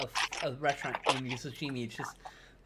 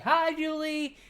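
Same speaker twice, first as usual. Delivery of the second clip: bright, much higher call of hello; excited greeting; 13 semitones higher, 5 dB louder, slower than usual. Real, not acted. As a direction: greet her with a big happy lift. Greeting viewer with delight.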